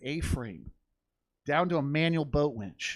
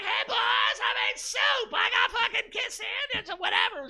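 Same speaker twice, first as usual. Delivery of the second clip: yelling grandma. Retold or imitated. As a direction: hang in the air and yell cheerfully about soup. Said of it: "She's yelling".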